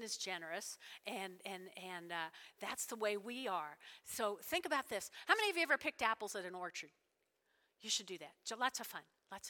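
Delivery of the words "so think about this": ordinary talk, nothing marked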